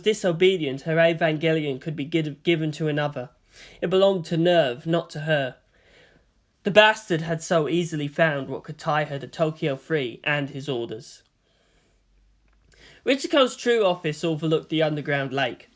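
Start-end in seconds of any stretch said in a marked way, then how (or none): none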